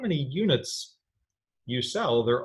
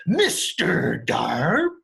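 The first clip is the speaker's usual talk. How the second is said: slow, drawn-out goofy voice, a touch of the character; light Mister Darp voice; 5 dB louder, much slower than usual. Retold or imitated. Teacher slipping partly into the Darp voice for students. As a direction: lean lightly into the odd voice. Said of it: "make a weird voice".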